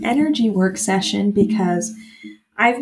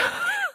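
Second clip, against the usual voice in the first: short laugh that jumps very high; a quick breathless laugh before answering; high teary laugh; 8 dB quieter than usual. Real, not acted. While retelling her grandma's sign, a high teary laugh.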